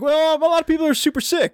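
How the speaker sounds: high, sheepish mock confession; reluctant admission. Submarine captain answers the council, high and sheepish.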